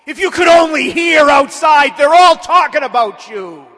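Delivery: devil shouting accusations, loud and high; full-volume demonic harangue, voice pushed high; grotesque devil voice. Acted. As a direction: yell it as a taunting, grotesque demon. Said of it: "with his grotesque voice".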